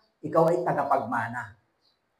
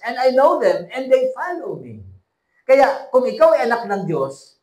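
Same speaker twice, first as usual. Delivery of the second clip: fiery preaching; 6 semitones higher, 7 dB louder than usual. Acted. Pastor preaching loudly to his congregation. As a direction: lift the church with your voice.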